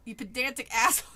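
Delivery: mock-angry outburst; annoyed rant. Real, not acted. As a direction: tell off the pedantic waiter. Scolding the pedantic book waiter.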